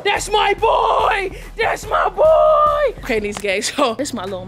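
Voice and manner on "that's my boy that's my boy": slow, drawn-out shout that climbs much higher the second time; proud shout. Real, not acted. Owner cheering her dog's trick, stretched-out proud yell. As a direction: cheer like your team just scored. Dog barks right finger count.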